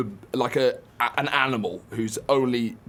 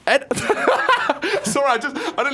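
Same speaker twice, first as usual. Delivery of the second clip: much higher laugh, then apology still laughing; high burst of laughter; 12 semitones higher than usual. Real, not acted. Listener cracking up at the nan's train story, high laugh. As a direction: squeal of laughter at the punchline.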